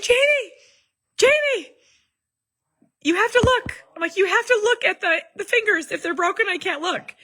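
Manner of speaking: begging husband to check, scared; retold panic. Panicked about son's hurt fingers.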